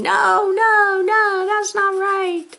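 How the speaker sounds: high, loud sing-song no no no; brain camera voice. Pretend. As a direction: sing out the alarm like a cartoon. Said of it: "the camera in my brain is going".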